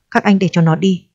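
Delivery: ordinary delivery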